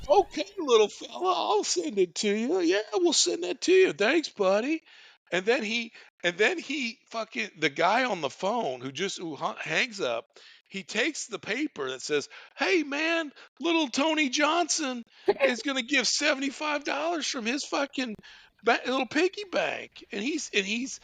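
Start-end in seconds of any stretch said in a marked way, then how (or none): 0.1-4.8 high-pitched friendly mock stoner; stoner impression. Stoner volunteer thanking little fella on phone, stoner voice. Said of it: "going back to the stoner". 12.6-20.0 high-pitched mock stoner relaying news; stoner impression. Stoner telling colleague of piggy bank donation, stoner voice. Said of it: "going back to the stoner".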